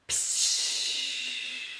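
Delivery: breathy spray sound for the trunk; imitated water squirt; squirting psh noise. A narrator's reading.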